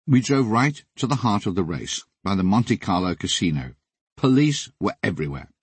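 ordinary delivery

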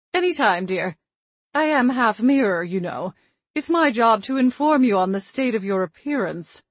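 prim grandmotherly ghost, matter-of-fact; old grandmother voice. Acted.

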